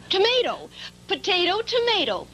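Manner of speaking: proud answer. Real, not acted. Child proving potato and tomato rhyme, gleeful and high. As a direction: sing out the rhyming pair. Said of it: "child solving rhyme".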